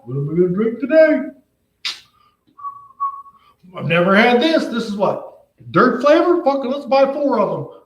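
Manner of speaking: high, dopey mocking voice; clueless shopper. Mocking a dumb shopper picking sodas, in a high dopey voice. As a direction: play a clueless shopper being mocked. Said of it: "You stand like a fucking dumbass?".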